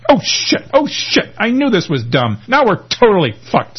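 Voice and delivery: high, panicked-angry outburst; furious. A narrator's reading. Tom sees who is driving the getaway car, furious. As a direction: curse in high-pitched fury, punching each exclamation. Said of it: "says Tom furiously".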